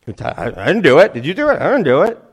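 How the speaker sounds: high, loud, swooping protests; hammed-up innocent bystanders; flustered crowd denials. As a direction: deny it in mock panic. Acting crowd members denying the touch.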